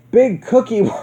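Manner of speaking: usual speaking voice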